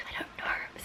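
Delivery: playful, frightened whispering; scared whisper. Pretend. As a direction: whisper your nerves like a confession. Playfully admitting fear of the book.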